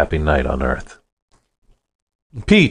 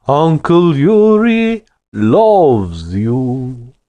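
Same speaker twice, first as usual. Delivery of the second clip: very slow, loud, high sing-song menace; sickening false sweetness; 8 semitones higher, 4 dB louder, much slower than usual. Acted. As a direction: croon it slowly with fake love. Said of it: "sang the words with a sickening false sweetness".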